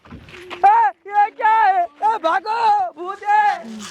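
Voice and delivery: high frightened cry of ghost; scared cry. Acted. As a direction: yelp in fright. Policeman sees the ghost.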